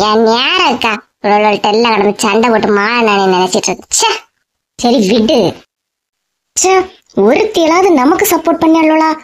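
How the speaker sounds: ordinary talk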